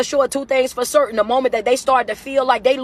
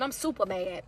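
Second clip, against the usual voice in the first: much softer, lower, almost under the breath; reading a comment softly; 6 semitones lower, 16 dB quieter than usual. Retold or imitated. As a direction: murmur the comment as if reading it off the screen.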